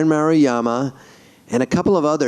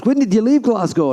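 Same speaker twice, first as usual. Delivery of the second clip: high Glaswegian lilt; 6 semitones higher than usual. Retold or imitated. Singer asking when he left Glasgow, Scottish accent. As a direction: imitate a Glasgow man's accent. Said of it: "He goes".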